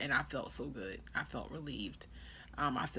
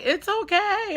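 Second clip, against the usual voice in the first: reassuring nurses; 7 dB louder, slower than usual. Retold or imitated. Nurses reassure her in the story.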